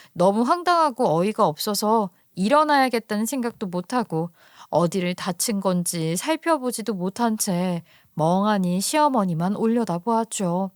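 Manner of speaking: plain reading voice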